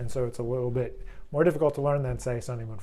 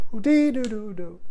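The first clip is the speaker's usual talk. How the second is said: sung high with a punch on dee; accented scat line; 7 semitones higher, 3 dB louder than usual. Shown as a demonstration. Teacher shows where the accent falls in the line. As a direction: hit the downbeat hard. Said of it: "think about the way it's accented".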